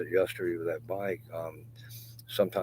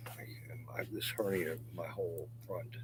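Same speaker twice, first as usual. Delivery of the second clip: normal talking voice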